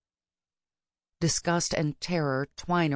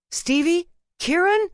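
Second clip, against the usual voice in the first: high, loud, tight questioning calls; frantic calling; 14 semitones higher, 5 dB louder than usual. A narrator's reading. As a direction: call out frantically for missing friends. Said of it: "voices call out from the other side of the door, frantic and tight".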